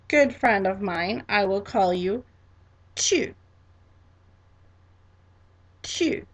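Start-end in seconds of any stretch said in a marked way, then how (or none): 2.9-3.3 "tu" said crisply in French for repeating; model pronunciation. Shown as a demonstration. Teacher has class repeat informal you, model pronunciation. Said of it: "pronounce after me". 5.8-6.2 "tu" said crisply in French for repeating; model pronunciation. Shown as a demonstration. Teacher has class repeat informal you, model pronunciation. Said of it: "pronounce after me".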